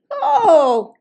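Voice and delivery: high, loud gasp of an oh with a laugh; surprised oh. Acted. Little girl finding the mirror, delighted surprise. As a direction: let the surprise pop out. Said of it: "she said in surprise".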